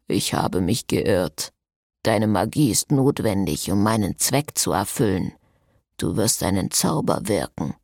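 deep, dragged vampire threat; cold vampire. Acted. Salvatore, the vampire, speaks.

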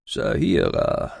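slow, low croak of an ancient man; croaking old man. Acted. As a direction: croak it out like a frail elder. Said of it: "krächzte der Alte".

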